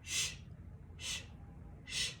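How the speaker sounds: "sh" hissed softly with no voice; soft whispered consonant drill; hushed sh sound. Shown as a demonstration. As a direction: breathe out "sh" quietly. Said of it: "repeat after me".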